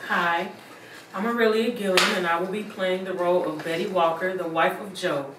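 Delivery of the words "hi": ordinary delivery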